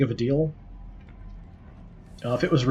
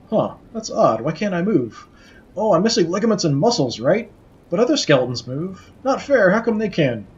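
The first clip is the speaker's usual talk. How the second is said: petulant, pitched-up complaining; whiny skull; 5 semitones higher, 4 dB louder than usual. Acted. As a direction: sulk like a spoiled child. Skull whines he can't move.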